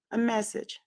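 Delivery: ordinary delivery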